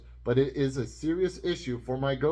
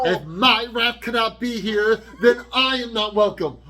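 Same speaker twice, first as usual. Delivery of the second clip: high, loud mock-indignant outburst; hammed-up imitation of an offended teenager; mock dramatic; 9 semitones higher, 8 dB louder than usual. Daughter refusing to stay without her rat, mock dramatic. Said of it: "This is so dramatic".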